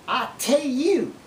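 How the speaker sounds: "I tell you." slow, "tell" as "tay"; Okie accent. Shown as a demonstration. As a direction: drop the final L of "tell". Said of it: "we also do it with the word tell".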